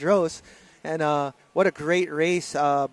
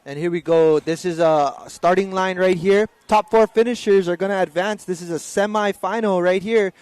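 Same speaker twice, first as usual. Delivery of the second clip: louder, driving play-by-play as the semifinal starts; live race call; 5 dB louder than usual. Acted. Commentator calling the semifinal start to online viewers, louder and energised.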